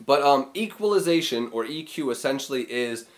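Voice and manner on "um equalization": ordinary delivery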